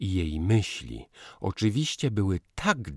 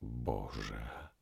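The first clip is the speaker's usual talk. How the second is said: low, much softer moaned Boże; soft groan; 6 semitones lower, 11 dB quieter than usual. A narrator's reading. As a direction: moan Boże under breath. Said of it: "jęknął".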